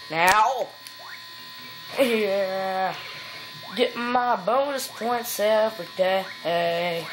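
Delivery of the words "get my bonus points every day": usual speaking voice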